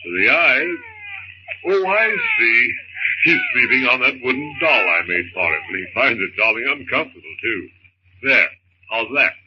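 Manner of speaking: calm his frightened wife in a deep voice; husband's voice. Acted. John soothes baby.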